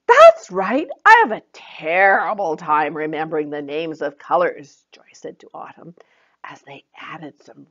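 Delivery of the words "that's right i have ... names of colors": Joy encouraging. Acted. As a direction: praise her and leave a gap. Joy pleased.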